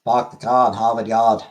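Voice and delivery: loud Boston accent, dropped R's. Shown as a demonstration. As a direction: say it like a Boston local, pahk the cah. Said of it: "I have a Boston accent".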